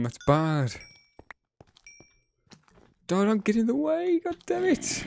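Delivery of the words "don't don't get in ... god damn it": much higher, squeaky voice scolding the dog. Acted. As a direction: squeak it out like a fussed little character. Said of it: "Don't get in the way of the arrows".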